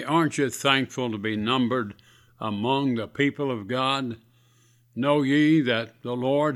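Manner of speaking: ordinary talk, nothing marked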